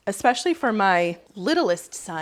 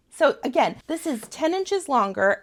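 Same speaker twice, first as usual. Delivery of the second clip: normal talking voice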